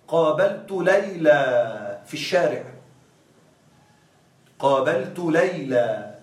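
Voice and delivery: slow, deliberate example sentence; slow teaching demo. Shown as a demonstration. Teacher showing the students Layla without tanwin, slowly. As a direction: spell it out slowly for the class. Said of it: "لا أقول ليلًا".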